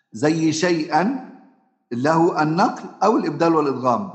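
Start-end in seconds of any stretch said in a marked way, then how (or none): 0.5-1.3 شيئا said slowly with the hamza; recited with hamza. Shown as a demonstration. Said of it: "فيبقى له فيه وجهان".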